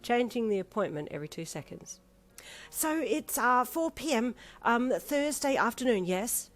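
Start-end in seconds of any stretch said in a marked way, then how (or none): 2.7-6.5 high, louder flustered chatter; a dithery caller in a fluster; high, fussy flustered voice; nervously agitated old lady. Acted. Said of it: "in a nervously agitated tone".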